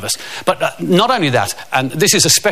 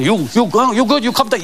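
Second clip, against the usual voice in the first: put-on higher, broken-English Swedish accent; 7 semitones higher than usual. The Swedish buddy calls to him, broken-English Swedish accent. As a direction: Eric checks on his panicking buddy, Swedish accent. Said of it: "And Eric pops up".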